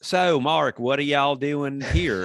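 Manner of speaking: hammy Southern line reading; put-on Southern accent. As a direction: overdo a bad audition Southern accent. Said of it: "Why are you doing an accent?".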